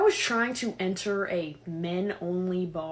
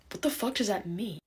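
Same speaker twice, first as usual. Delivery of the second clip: ordinary delivery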